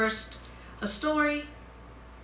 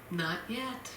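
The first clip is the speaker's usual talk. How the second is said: calm refusal; 9 dB quieter than usual. Acted. Potter refusing calmly.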